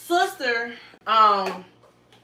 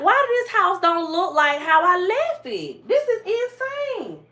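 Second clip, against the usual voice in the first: high-pitched retold shock at a messy house; climbing higher in exaggerated disbelief; imitating her own outraged surprise, pitched way up; mock disbelief; 9 semitones higher than usual. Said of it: "I was like".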